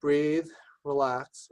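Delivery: slow it right down, leaving room to breathe; deliberately slowing down. Shown as a demonstration. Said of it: "slow it down for a second".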